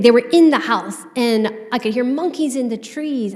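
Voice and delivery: ordinary talk, nothing marked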